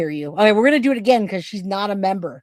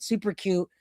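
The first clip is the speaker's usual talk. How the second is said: ordinary talk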